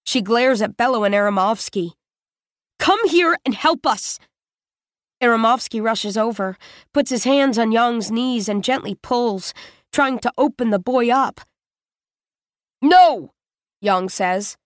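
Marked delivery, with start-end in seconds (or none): 2.8-4.2 high, urgent summons; urgent call for help. Acted. Spingate calling Bello and Aramovsky over, urgent. 12.8-13.4 loud, high refusal; pained no. Acted. Stabbed boy refusing as his knees are pulled, pained.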